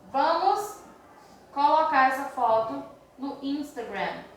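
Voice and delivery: measured, piece by piece translation. Shown as a demonstration. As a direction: pause after the first word. Translating slowly, as if writing it.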